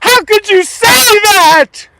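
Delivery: shrill falsetto screech, very high, loud and slow; mock outraged viewer. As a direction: squeal it like an offended busybody, way up high.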